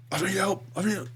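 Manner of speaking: high-pitched imitation of a call for help; radio distress call. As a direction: imitate an officer calling for help over the radio.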